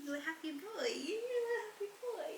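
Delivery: quiet cooing pet voice; soft high pet talk. Real, not acted. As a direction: murmur fondly to the cats. Soft baby talk to cats.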